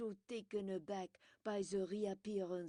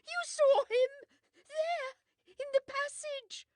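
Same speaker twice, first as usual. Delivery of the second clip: shrieking, much higher and louder; hysterical cry; 12 dB louder than usual. A narrator's reading. Widow to Poirot after seeing a ghost, terrified shriek. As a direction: scream it out in terror. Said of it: "she cried".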